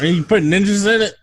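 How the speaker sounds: high, loud put-on producer voice; producer impression. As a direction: pitch it way up as the pushy producer. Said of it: "he's like".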